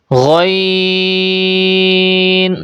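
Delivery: غین held very long with a gargled gh; drawn-out, full-mouthed غین; thick upper-throat sound. Shown as a demonstration. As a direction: recite غین heavy and rounded on a steady note. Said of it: "موٹی آواز سے پڑھیں گے".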